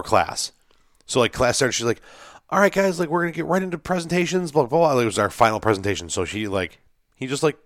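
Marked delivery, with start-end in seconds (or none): 2.5-4.9 high, sing-song teacher voice rattling off the plan; mock professor voice. Mocking the professor addressing the class, high brisk voice. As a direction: imitate a brisk lecturer opening class.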